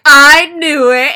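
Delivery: loud, high gleeful shout of triumph; excited cheer. Retold or imitated. Friends cheering over her secret boyfriend, shouting gleefully. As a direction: cheer it out like you called it.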